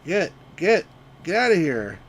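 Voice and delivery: loud shoo at the flock; shooing shout. Real, not acted. Shooing the sheep.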